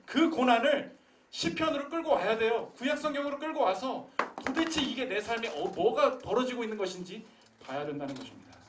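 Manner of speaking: loud, high fervent preaching; preaching at full force. Acted. Pastor challenging the congregation, loud fervent preaching. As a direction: drive it home to the pews.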